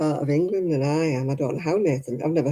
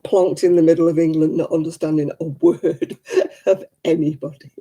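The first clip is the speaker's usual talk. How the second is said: real laughter breaking into the words; tickled, laugh through the sentence; 5 dB louder than usual. Real, not acted. Laughing at imagined confused Miguel.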